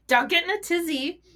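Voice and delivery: high, teasing sing-song warning; playful mock scolding. To listeners about getting riled up, high playful teasing. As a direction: tease the listener like a fussing aunt.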